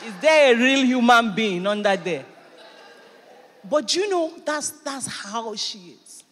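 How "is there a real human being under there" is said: louder, pleading call; fast then shouting, half joking; exasperated shout. Retold or imitated. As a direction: demand to see behind the mask.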